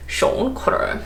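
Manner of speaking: Sean Connery. Acted. Vlogger joking she sounds like an actor, light impression. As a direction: lightly mimic the film star's sh. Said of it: "That's what I sound like".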